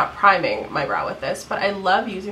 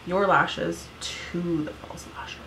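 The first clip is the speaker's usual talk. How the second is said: whisper; 2 semitones lower, 5 dB quieter, slower than usual. Real, not acted. Makeup tutorial aside.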